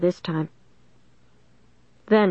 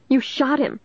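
high, distraught wail building higher; 8 semitones higher than usual. A narrator's reading. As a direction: wail it in horror. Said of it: "Caitlin wailed".

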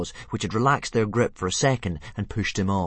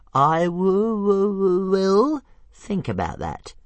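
high stuttering dragon voice; slow, high squeaky stutter; Toothless; 13 semitones higher, 8 dB louder, slower than usual. Acted. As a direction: stammer a grudging maybe. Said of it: "said Toothless".

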